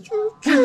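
high mock "choo, choo" laugh; playful high-pitched laugh imitation; imitated laugh. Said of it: "her laugh for me is like".

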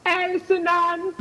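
squeaky high drawn-out name; mock high voice. Taunting an opponent, squeaky high voice. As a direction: sing the name up high and slow.

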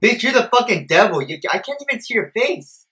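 ordinary talk

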